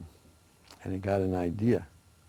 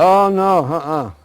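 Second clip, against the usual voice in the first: much higher, louder playful no; teasing refusal; 8 semitones higher, 11 dB louder, slower than usual. Acted. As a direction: refuse them with a sing-song tease. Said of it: "He said".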